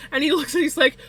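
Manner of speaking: ordinary delivery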